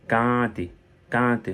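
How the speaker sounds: "can't" as French "kaante", long A, sounded T. Shown as a demonstration. Teacher drilling learners, exaggerated "kaante". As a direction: stretch the A and release the T in "can't". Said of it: "K A A N T E en français".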